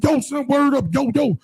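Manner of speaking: high-pitched mock New York yo son; New York slang. Imitating the New York dude, high and playful, in a New York accent. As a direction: toss off a squeaky New York yo. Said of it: "He's like".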